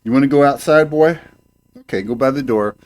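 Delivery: high, coaxing pet-owner voice; sing-song call to a pet; talking to the cat. Real, not acted. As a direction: coax the cat toward the door.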